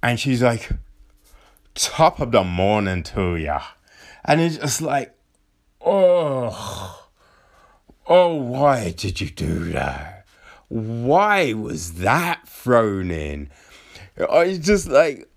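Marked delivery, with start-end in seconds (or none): 1.7-3.6 low, gruff mock-Irish greeting; put-on Irish brogue, pitched down; gruff Irish accent. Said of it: "putting on this weird gruff accent". 5.8-13.5 low, pained mock dismay; drawn-out, despairing complaint; exasperated groan. Acted groaning reaction to clunky line.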